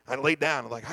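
usual speaking voice

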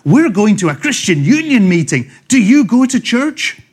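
eager, over-keen youthful pitch; excited students. Retold or imitated. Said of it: "we're youthful, we're vigorous, we're excited".

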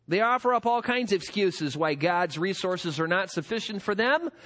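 ordinary talk

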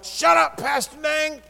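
high, loud mock yell at the pastor; retold shout. Telling the pastor off, a high loud mock shout. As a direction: bark it back high and loud, half joking. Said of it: "I'm like".

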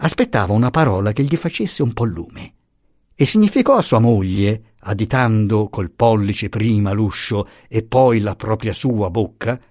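usual speaking voice

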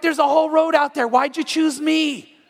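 high, loud mock indignation; acted road frustration, dragging out the last words; exasperated complaint. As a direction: protest like a put-upon driver. Acted driver stuck behind slow car.

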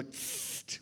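whispered zapping hiss; unvoiced hiss like a weapon; spray can hiss. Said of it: "Or we'd go".

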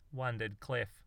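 usual speaking voice

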